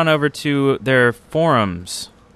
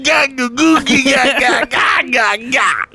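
shouted laugh; 11 semitones higher, 4 dB louder than usual. Real, not acted. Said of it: "I can't even read today".

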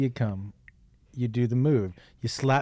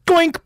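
high cartoon goink; Stooges sound effect; 7 dB louder than usual. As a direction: squeak out a silly goink. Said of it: "It goes like this".

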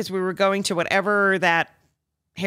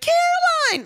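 much higher and louder joyful call; delighted greeting call; 4 dB louder, slower than usual. Retold or imitated. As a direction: call her name like an old friend. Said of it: "she's like".

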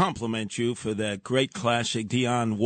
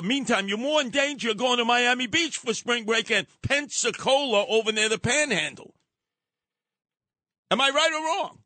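rising rant; 10 semitones higher than usual. Real, not acted.

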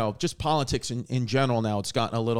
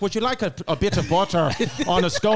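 mock Irish accent; 3 semitones higher, 4 dB louder than usual. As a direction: put on a comic Irish brogue, close to laughing. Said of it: "has developed an uncontrollable Irish accent".

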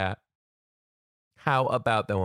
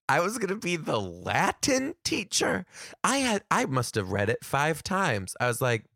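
usual speaking voice

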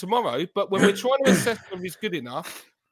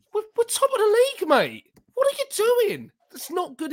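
much higher, fast, exasperated outburst; exasperated disbelief; 14 semitones higher than usual. Real, not acted. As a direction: scold him in disbelief at the miss. Frustrated fan scolding the striker.

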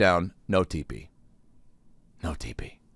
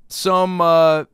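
ordinary delivery

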